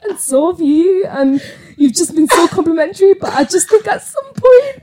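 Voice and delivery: high-pitched tearful wobble, mimicking a breakdown; voice shaking, tearful. Retelling a tearful promotion plea to the director, voice shaking. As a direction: re-enact pleading on the verge of tears, laughs breaking through. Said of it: "your voice is shaking".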